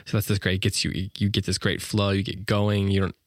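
ordinary talk, nothing marked

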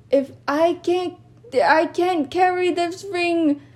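high, slow, sobbing-laugh hammed line; overacted tearful hero cry; dramatic Sam voice. Sam's line acted with laughing cry.

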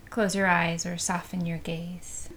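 normal talking voice

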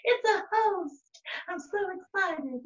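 high-pitched little leech voice; excited leech. Acted. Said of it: "Like a leech".

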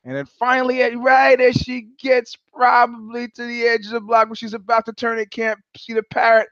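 usual speaking voice

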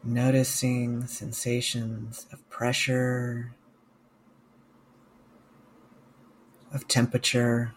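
slow, calm meditation guide with long pauses; guided meditation. Real, not acted. Mindfulness coach guiding listeners through a body scan, guided meditation. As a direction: guide listeners gently, leaving space after each phrase.

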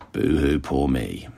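slow, low fake boo-hoo; mock self-pity, drawn out. Mock pity about own cold.

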